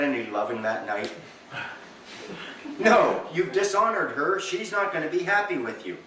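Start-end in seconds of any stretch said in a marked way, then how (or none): none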